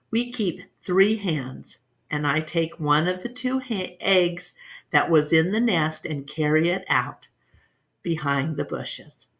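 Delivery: plain reading voice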